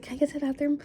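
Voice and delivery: high-pitched retold polite request; asking to leave. To a date, excusing herself at the table, high retold request. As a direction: re-enact a quick, high-voiced request. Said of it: "I was like".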